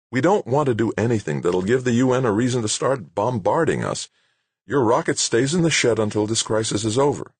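high-pitched, insistent director laying out the danger; urgent character voice, pitched well up; exasperated base director. Acted. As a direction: argue back as an official under pressure. Narrator voices director laying down rule.

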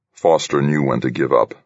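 ordinary delivery

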